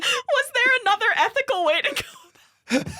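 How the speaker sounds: high, helpless giggly question; laughing self-defence. Real, not acted. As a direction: plead the case through laughter.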